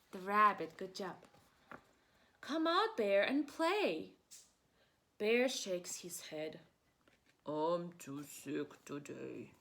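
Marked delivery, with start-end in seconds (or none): 2.4-4.1 higher, louder rabbit calling out; bright voice for the bunny; cheerful rabbit. Acted. Rabbit's line in story. 7.5-9.5 low, slow, weary bear voice; low droopy bear; sick bear. Acted. Sick bear's line.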